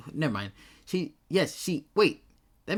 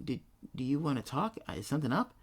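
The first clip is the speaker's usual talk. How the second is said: ordinary talk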